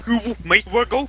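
very high, hammed-up British squawk; mock British screaming. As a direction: escalate the fake British challenge. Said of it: "British and screaming".

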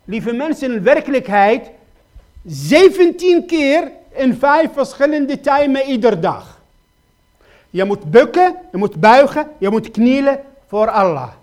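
loud, urgent pleading sermon voice; preacher crying out his questions to the congregation, loud and pleading; full-force preaching. Acted. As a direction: hammer each question home from the pulpit.

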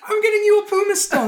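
very high loud insistent cry; exasperated girlfriend. Retold or imitated. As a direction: shriek it as a fed-up fix. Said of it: "And it's like".

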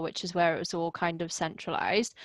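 ordinary delivery

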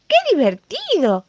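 high, loud thrilled cry; excited shout. Acted. Lion cub thrilled on his dad's back, excited shout. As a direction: squeal it like a delighted cub riding his dad. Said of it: "gritó el leoncito, entusiasmadísimo".

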